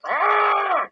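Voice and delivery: very high, loud imitated scream; angry yell. Angry handler yelling at her Dalmatian, imitated scream. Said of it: "That chick's angry".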